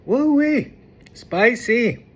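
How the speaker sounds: loud high whoop at the heat; excited. Real, not acted. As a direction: yelp it out, mouth burning. Reacting to spicy food.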